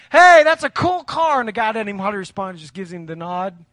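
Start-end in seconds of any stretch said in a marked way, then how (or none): 0.1-1.4 called out high and louder, slowly; hollered greeting. Retold or imitated. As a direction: holler across to the next car. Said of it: "he hollers at him".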